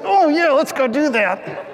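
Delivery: forced cheer. Retold or imitated. Dad hiding his pain from the kids, forced cheer. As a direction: fake being fine for the kids.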